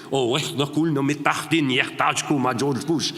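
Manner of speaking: movie-villain Arabic accent, hammed up.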